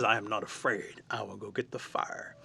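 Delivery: lightly voiced, grave volunteer; solemn raven. Raven volunteers to fetch the fire, hushed solemn vow.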